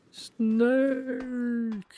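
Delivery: high drawn-out snake yell; a very bad snake impression. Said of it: "doing my very bad snake and yelling for a dead snake impression".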